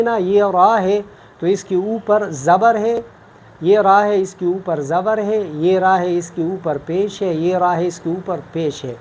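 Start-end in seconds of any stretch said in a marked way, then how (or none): none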